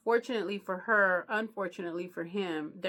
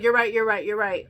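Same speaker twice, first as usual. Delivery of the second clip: loud backpedaling; 2 semitones higher, 9 dB louder than usual. Retold or imitated. Jerry backpedals to the husband.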